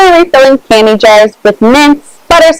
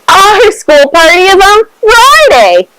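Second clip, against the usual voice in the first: piped in; 8 semitones higher than usual. A narrator's reading. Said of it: "Chung piped in".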